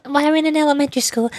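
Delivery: ordinary talk, nothing marked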